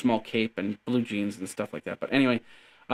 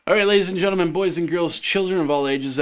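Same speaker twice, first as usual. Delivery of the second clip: mock ringmaster; 4 semitones higher, 3 dB louder than usual. Announcer-style show opening.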